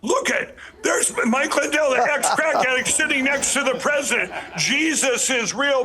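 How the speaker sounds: high, excited mock exclamation; playing his old friends gasping in wonder; amazed buddies. Old crack buddies seeing him beside the president, excited and high. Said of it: "my crack buddies are going".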